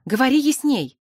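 brisk order to be clearer; interrupting. A narrator's reading. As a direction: cut in impatiently. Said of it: "перебила она".

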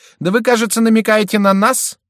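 very high, loud challenge; indignant cry. A narrator's reading. Шомберг challenges the hint at their guilt, indignant cry. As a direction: fling the question back indignantly, voice way up. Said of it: "вскричал Шомберг".

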